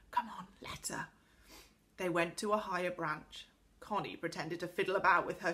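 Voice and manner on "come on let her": hushed whisper with a grin. A narrator's reading. Boy urging his companion to leave her be, whispering. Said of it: "whispered Joe to Beth with a grin".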